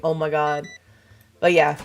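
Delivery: normal talking voice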